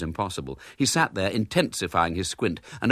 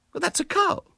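high, halting, flustered lady; stumbling over an obvious answer; flustered spinster. A narrator's reading. Miss Milton explaining grass, high and flustered.

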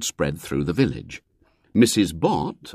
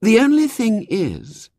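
mild sigh; 4 dB louder, slower than usual. A narrator's reading. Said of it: "she sighed".

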